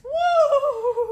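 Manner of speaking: high, loud whoop of excitement; excited woo. Real, not acted. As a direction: let out a thrilled woo. Reacting to getting chills.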